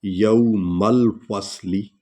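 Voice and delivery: very slow, यौमल with ya and waw read together; joined diphthong. Shown as a demonstration. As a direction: glide यौमल as one syllable, slowly. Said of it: "या और वा को इकट्ठे पढ़ेंगे". (यौमल में या और वा मिलाकर)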